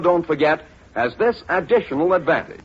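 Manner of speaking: usual speaking voice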